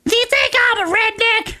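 high hammed-up Southern accent; Southern lady. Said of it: "she turns to me, and she goes".

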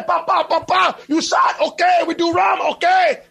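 put-on high shouted Puerto Rican accent. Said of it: "doing the Puerto Rican accent".

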